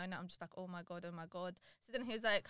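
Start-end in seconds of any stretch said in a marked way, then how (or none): 0.6-1.5 low, very soft mock whimper; retold crying. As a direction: murmur it low, as if retelling your tears. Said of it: "and I'm crying now".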